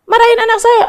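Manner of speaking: mock a parent protesting to a neighbour; angry parent. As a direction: play at being an offended mother. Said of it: "Kamu marah".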